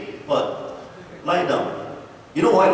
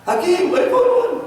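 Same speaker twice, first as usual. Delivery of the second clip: high excited calling out; high, loud joyful announcement; 5 dB louder than usual. Retold or imitated. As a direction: call out the good news, thrilled. Wife calling out to Hakim.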